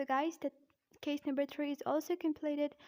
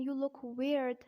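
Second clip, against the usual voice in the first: American "weird" with a sounded R; American-accent demo; slower than usual. Shown as a demonstration. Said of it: "in American accent".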